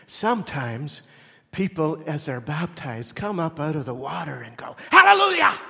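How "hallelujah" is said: high, loud ecstatic cry of praise; Pentecostal shout. Retold or imitated. Preacher imitating baptized believer, ecstatic shout. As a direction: burst out like a new believer. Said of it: "Sorry, I got Pentecostal on you".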